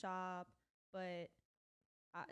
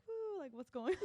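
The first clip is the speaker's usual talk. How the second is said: excited; 10 semitones higher, 5 dB louder than usual. Retold or imitated.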